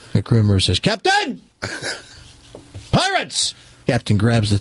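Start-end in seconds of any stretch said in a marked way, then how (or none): 0.8-3.5 high shouted alarm; alarmed call. Acted. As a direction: cry out the warning. Crew member sights pirates.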